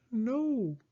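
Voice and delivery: shrinking Frog answering Toad's boast; tiny peep. Acted. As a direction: answer in a small squeak. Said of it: "peeped Frog".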